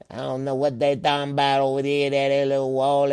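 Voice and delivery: ordinary delivery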